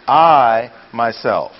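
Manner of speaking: I long and loud before myself; slow, emphatic I; stress on I. Shown as a demonstration. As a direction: punch I, let myself fall away. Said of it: "So the pronouns then".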